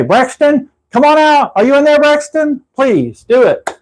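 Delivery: high, teasing call to someone locked inside; calling into the trunk. Shown as a demonstration. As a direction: pitch it way up and coax him out. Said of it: "and say".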